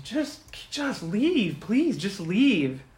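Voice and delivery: slow, high-pitched acted plea; pleading yell. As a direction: beg them to go, drawing it out. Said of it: "Yuki yells at".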